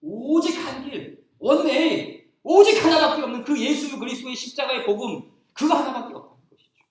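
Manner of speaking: insistent pulpit emphasis; emphatic preaching. Acted. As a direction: stress the one way.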